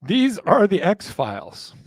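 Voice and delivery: slow, weighty announcement; dramatic reveal. Pretend. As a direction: present it like a big reveal.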